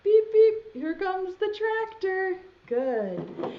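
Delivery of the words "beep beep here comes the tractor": high, loud beep beep; tractor noise. Pretend. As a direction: play at driving a tractor. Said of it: "pretend like you're driving the tractor".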